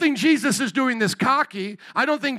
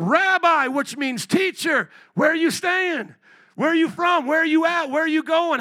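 eager questions; 6 semitones higher, 3 dB louder than usual. Retold or imitated. Disciples eagerly questioning Jesus.

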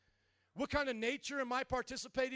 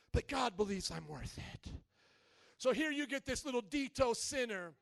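usual speaking voice

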